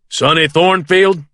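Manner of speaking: calls the name out loud and high; booming announcement of a name. A narrator's reading. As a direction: sheriff calling out loud. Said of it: "Dennis says loudly".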